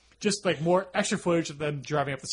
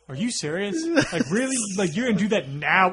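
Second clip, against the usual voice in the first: mock irate disbelief; 4 semitones higher, 4 dB louder than usual. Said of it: "I was really irate with you".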